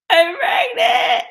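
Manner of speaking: high, loud, slow mock sob; retold crying wail. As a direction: replay the tearful shout on the phone. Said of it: "I'm overwhelmed with hormones and emotions".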